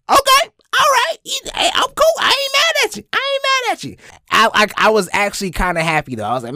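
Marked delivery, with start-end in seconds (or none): 0.1-4.0 very high squeaky mock concession; comic acceptance. Skateboarder to the kind driver, squeaky approval. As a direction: squeal it, as if won over. Acted reaction to surprise compliment.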